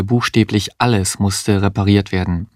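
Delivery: plain reading voice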